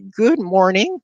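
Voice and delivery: a high nervous squeak. A narrator's reading. Frightened boy squeaks a greeting to the bully. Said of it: "I squeaked".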